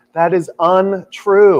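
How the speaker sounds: slow, loud, every word spaced out; indignant retort. Retold or imitated. To American Airlines' refund email, slow indignant retort. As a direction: lay it down slowly and firmly, offended.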